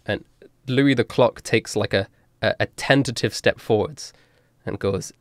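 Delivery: ordinary talk, nothing marked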